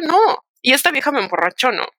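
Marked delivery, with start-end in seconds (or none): none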